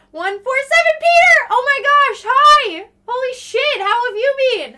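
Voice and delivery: very high, loud squealing hello; thrilled greeting. Real, not acted. Greeting a viewer on stream.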